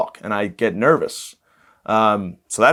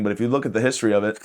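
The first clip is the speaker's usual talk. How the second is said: ordinary talk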